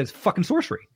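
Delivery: "It's fucking sorcery" blurted high with a rising end; retold astonishment, pitch jumping up on "sorcery"; wide-eyed outburst, "sorcery" lifted high; amazed exclamation. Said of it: "I said".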